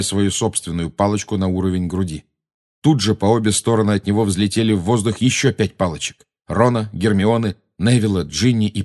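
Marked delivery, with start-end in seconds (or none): none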